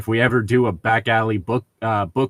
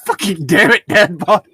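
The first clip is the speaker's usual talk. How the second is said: frustrated curse; 7 semitones higher, 7 dB louder than usual. Real, not acted. Frustrated at game bot.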